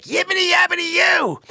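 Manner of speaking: very high shouted nonsense babble; gibberish squeal. Acted. Sonny sitting there blurting gibberish, squealed high. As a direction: squeal it out like a cartoon critter.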